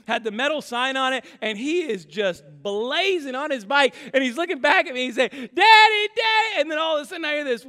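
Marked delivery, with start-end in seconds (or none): 5.3-6.6 put-on much higher, louder child's call of Daddy. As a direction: pipe up like a little boy calling his dad. Son calling while riding bike.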